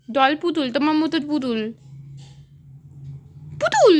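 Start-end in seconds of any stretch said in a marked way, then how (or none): 3.6-4.0 high, louder sing-song doll; excited high call. Real, not acted. Mother calling out the word to her toddler, high and loud. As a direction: call it out brightly to grab attention.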